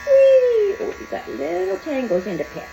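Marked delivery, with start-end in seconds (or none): 0.1-0.8 loud, very high squeal; squealing whee. Real, not acted. Groomer squealing playfully while clipping the paws. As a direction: squeal it with glee.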